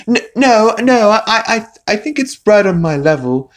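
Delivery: high, loud stammering objection; squeaky flustered pleading; mock nervous protest. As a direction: stumble over it like an intimidated kid. Stewie nervously protests to bully.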